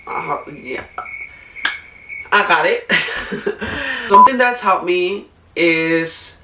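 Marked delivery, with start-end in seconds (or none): 2.9-4.0 high, soft giggle; laugh. Real, not acted. Vlogger amused at herself mid-recording, soft high giggle. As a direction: giggle lightly to yourself.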